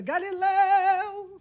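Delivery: loud, long drawn-out cry, pitched high; prayer-cry intonation. Retold or imitated. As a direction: imitate an over-fervent camper's sudden outburst. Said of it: "Nessa entonação".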